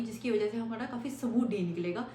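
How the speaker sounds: ordinary talk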